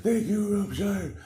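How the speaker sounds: high little-creature voice; grateful creatures. Acted. Forest creatures thanking their rescuer, grateful high voice.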